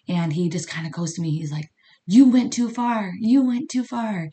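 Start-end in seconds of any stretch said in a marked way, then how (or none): 2.1-4.3 higher, louder and slow, a firm warning; stern warning. Retold or imitated. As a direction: lay down the law slowly, voice raised. Joe warns her sternly.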